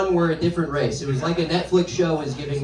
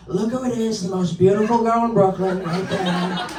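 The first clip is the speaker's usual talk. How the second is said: self-admiring politician impression, slightly raised pitch; Trump; 3 semitones higher than usual. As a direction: admire yourself in the mirror in a drawn-out political parody. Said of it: "They talk exactly like Trump".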